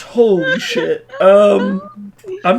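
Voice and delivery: breaking into laughter mid-line; can't keep it together; helpless laugh at a terrible roll; laughing in disbelief. Real, not acted. Player laughs after a bad roll.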